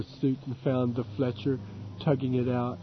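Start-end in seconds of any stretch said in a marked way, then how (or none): none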